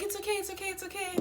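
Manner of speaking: high, soft, soothing repeat; gently calm a crying little sister. Retold or imitated. Sister comforting her crying sibling, high and soft. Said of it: "she's like".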